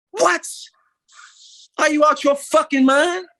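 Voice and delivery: loud, high, real furious yell; furious outburst. Real, not acted. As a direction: explode in real frustration. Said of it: "I'm so frustrated right now".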